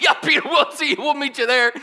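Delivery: high, loud, amused holler; laughing call after Peter. Retold or imitated. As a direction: shout it after him through laughter. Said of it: "They're like".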